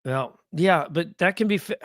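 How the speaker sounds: ordinary talk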